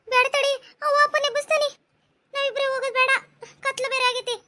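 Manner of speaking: high, tense scared voices; startled by a noise in the dark; frightened girls. Acted. Two girls frightened by a noise at night, high and tense.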